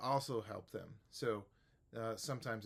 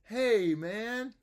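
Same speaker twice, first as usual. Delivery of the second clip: much higher and louder hey man; teacher model; 11 semitones higher, 11 dB louder than usual. Shown as a demonstration. Teacher demonstrates greeting loudly and high. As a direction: call it out to the student. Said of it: "I'll be a small student".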